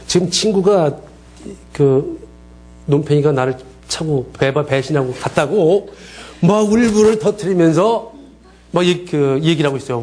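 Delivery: ordinary talk, nothing marked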